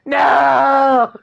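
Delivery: loud high protesting shout. Real, not acted. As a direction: yell it in mock panic. Full name said.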